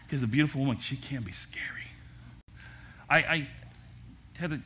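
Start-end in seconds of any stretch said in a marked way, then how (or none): 0.8-1.8 whisper. Acted. Joking aside about his wife.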